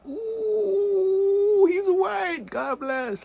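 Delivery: very high, loud squeal of relief; high-pitched mock relief. Mocks relieved reaction to news.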